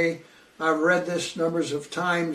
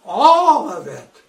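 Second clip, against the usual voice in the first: emphatic burst; 4 semitones higher, 12 dB louder, slower than usual. Real, not acted. Preacher stresses total judgment.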